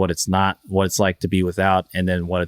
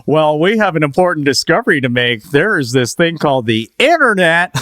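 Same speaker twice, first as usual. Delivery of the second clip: louder, higher TV-host announcement voice; Good Morning America; 6 semitones higher, 5 dB louder than usual. Acted. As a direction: announce a big discovery like a morning-show presenter. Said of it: "That's a good impression".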